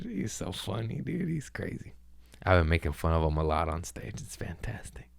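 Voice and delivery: quiet unvoiced whisper; breathy aside, half to himself. Real, not acted. Whispering to the host about roasting his dad on stage.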